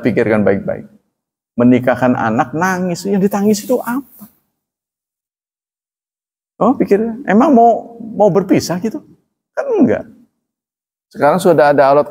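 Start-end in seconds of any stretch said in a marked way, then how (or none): 2.6-10.0 mock bafflement. Mock questioning the crying parent.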